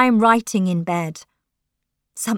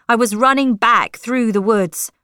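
ordinary delivery

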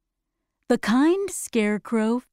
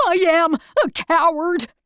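sad lion; 7 semitones higher, 5 dB louder than usual. Acted. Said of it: "said the lion sadly".

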